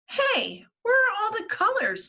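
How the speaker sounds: high, fast alarmed question; suddenly notice they're gone; Violet's high quick alarm; surprised crayon. Acted. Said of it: "says Violet".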